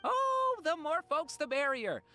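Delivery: delighted exclamation. Acted. Greeting new guests warmly.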